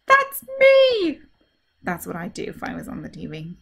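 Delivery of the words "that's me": high, loud, delighted shout; excited recognition. Acted. Virus spotting himself on TV, excited high shout. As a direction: squeal at seeing yourself on TV. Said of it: "he's saying".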